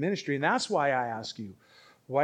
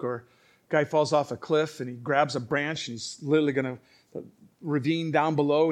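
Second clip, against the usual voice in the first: normal talking voice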